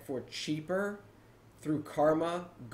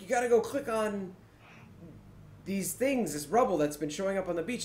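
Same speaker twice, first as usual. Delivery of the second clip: higher-pitched explaining voice; imitated Mumble player; 6 semitones higher than usual. Player explaining the beach task, imitated in a higher voice. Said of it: "And he's like".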